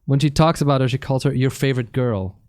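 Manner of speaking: normal talking voice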